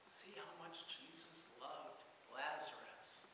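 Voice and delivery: whispered in awe, the voice almost gone; soft awed whisper, no voice behind it; hushed whisper. Acted. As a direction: breathe it like a marvelling onlooker. Crowd remarks on Jesus' love.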